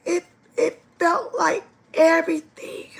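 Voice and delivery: real tears, breathless whisper; crying whisper. Real, not acted. Recalling the dance before the accident, whispering through tears. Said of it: "I'm just getting emotional".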